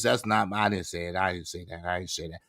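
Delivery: low, soft muttered denial; muttering quietly. Real, not acted. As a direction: mutter it under your breath. Denies a chat accusation.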